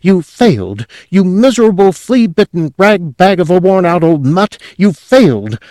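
high loud furious scolding; furious high loud tirade; grating rage. A narrator's reading. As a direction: tear into the dog. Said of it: "he grated".

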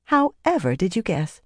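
sugary mock wonder; put-on sweetness, voice lilting; sweetly. A narrator's reading. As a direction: feign sweet, innocent amazement. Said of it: "Elaine said sweetly".

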